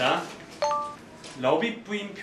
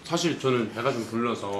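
talking while chewing; mouth full. Real, not acted. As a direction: food in mouth. Seen 1: Green-shirt man holds pie at mouth, biting, during the words.

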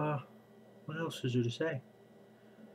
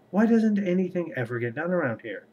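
exasperated outburst; 6 semitones higher, 6 dB louder than usual. Acted. Morgan's frustrated outburst.

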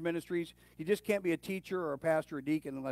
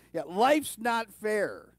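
loud, higher, drawn-out mock-parent comeback; parent's retort; 6 semitones higher, 7 dB louder, much slower than usual. Parents answering their teens, loud drawn-out retort. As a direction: stretch it out loudly like a parent laying down the law. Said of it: "ever heard their parents say".

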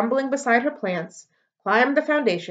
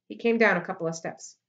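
ordinary delivery, nothing marked

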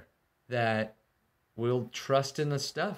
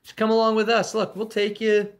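much higher, louder sweet-talking envoy; coaxing salesman voice; 8 semitones higher, 5 dB louder than usual. Retold or imitated. Assyrian envoy luring Jerusalem out, higher and honeyed. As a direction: lure them out with a false smile. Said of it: "he promises good things".

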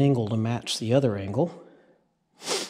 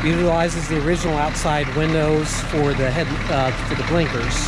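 shouting over machinery; 3 semitones higher than usual. Real, not acted. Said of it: "we're gonna shout over the engine".

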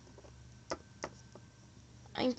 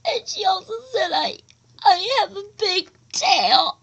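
loud sobbing words, fast and higher; higher, louder crying complaint; 6 semitones higher, 8 dB louder than usual. Acted. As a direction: blurt it out through sobs. Bullied child breaks down crying.

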